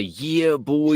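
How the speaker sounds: put-on "Yeah, boy" with YEAH drawn out and swooping high; long, high hype-man "Yeah, boy"; stretched-out catchphrase. Shown as a demonstration. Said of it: "You gotta say".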